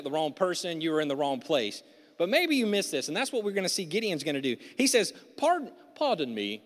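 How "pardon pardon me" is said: put-on British accent for Gideon. Preacher playing Gideon answering the angel, British accent. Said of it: "Maybe he had a British accent".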